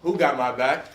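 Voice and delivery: shouted call to the crowd; loud rallying call. Real, not acted. Speaker asking students to scream back, loud call. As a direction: fire it out loudly for an answer.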